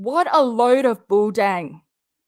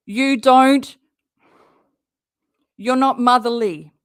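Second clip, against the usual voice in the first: louder, cutting accusation straight at Katie; louder, scolding Katie for lying; angry call-out; 5 dB louder than usual. Real, not acted. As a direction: tell her off to her face. Calling out Katie directly.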